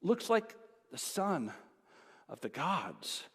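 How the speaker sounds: awed hush. Retold or imitated. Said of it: "He said".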